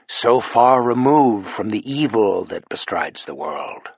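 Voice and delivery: far removed drawn out slowly; like his own words ringing in his ears; slow, haunted echo; slow, echoing memory. Acted.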